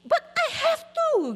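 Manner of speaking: put-on high, loud, drawn-out kid's protest; slow, insistent high whine of protest; protesting child. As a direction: imitate a child arguing back. Child protests to parent, retold.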